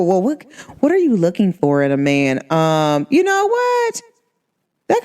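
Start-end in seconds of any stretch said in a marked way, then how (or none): 3.1-5.0 high-pitched, drawn-out sing-out; sudden polite brush-off. Acted. Woman cutting short a bad date chat, high-pitched brush-off.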